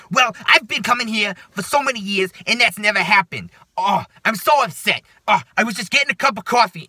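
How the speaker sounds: high, loud, upset New York whine; mock a distraught New Yorker; New Yorker accent. Longtime customer complaining to the bosses, mock-upset New York accent. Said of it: "like, New Yorker accent".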